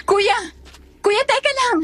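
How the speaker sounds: high, urgent call; pleading shout-after, pitch leaping up; calling after someone. Acted. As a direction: call out to stop a brother leaving. Calling after departing brother.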